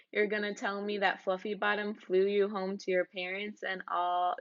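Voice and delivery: ordinary delivery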